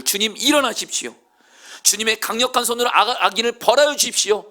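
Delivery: high, loud, forceful plea; fervent prayer. Acted. David praying for God to strike the wicked, forceful loud plea. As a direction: call on God with full power. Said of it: "이렇게 말했던 다윗이".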